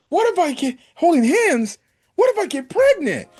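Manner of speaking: very high, hammed-up alarmed squeal; mock panic. Girl panicking at holding hands with boyfriend, comic alarm. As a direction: play her freaking out, pitch leaping up.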